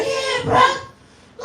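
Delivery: high, upset brother's voice; little brother. Retold or imitated. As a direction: squeal it like a panicked kid brother. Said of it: "He like".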